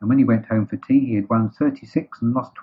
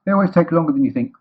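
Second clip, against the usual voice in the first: quick, raised, louder voice; Rabbit; 8 semitones higher, 6 dB louder, faster than usual. Acted. Rabbit telling Roo to wait, brisk and loud. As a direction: answer briskly and higher.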